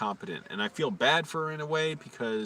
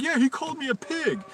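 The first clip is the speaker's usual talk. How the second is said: very high-pitched mocking whine; whining tattle; 13 semitones higher than usual. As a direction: imitate a whiny coworker telling on him. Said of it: "he went and whined to the manager".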